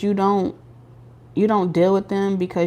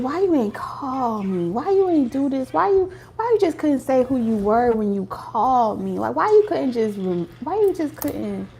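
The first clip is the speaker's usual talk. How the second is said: imitated tender little complaint; much nicer; 3 semitones higher, 4 dB quieter than usual. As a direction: plead gently in a sweet, higher voice. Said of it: "they said it, like, so much nicer".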